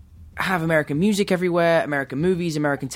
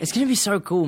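excited whisper; 5 semitones higher than usual. Real, not acted. Excited hushed anticipation.

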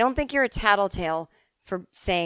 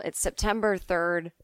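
ordinary delivery

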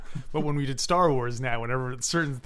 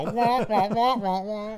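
high muffled trombone wahs; Charlie Brown teacher; 6 semitones higher, slower than usual. Retold or imitated. Teasing co-host's trombone as cartoon teacher, high wahs. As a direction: wah along like a cartoon grown-up. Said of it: "teacher on the Charlie Brown cartoons".

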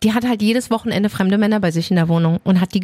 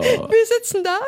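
laughing; 12 semitones higher than usual. Real, not acted. Laughs retelling the scene.

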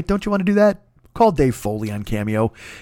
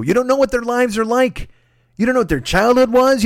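exasperated rant; 12 semitones higher than usual. Real, not acted. Host scolding people fighting over flags, exasperated rant. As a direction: plead and scold at a high pitch.

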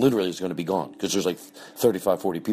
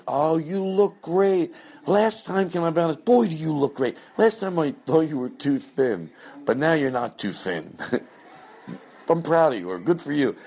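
shower the guest with praise, much higher and louder; gushing fake compliments; 9 semitones higher, 3 dB louder than usual. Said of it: "it's very like".

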